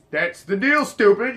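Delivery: retold snappy put-down; mock exasperation. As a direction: act out an impatient comeback.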